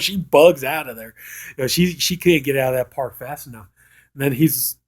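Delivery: usual speaking voice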